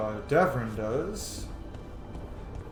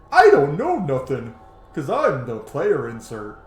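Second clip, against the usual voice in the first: loud, slow mocking dimwit voice; mock a hero who knows nothing; hammed-up clueless hero, drawn out and loud; clueless player insert; 8 dB louder, slower than usual. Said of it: "better way to write it than just".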